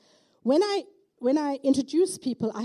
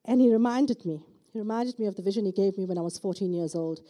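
ordinary talk

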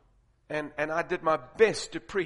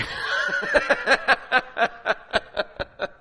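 loud, high, long laugh; burst of laughter; 7 semitones higher, 8 dB louder than usual. Real, not acted.

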